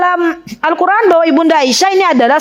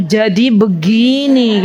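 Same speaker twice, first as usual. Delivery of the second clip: low, drawn-out mock confession opener; typical confiding opener; 5 semitones lower, much slower than usual. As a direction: stretch it out slowly, imitating someone about to confide. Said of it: "Kan khasnya begitu".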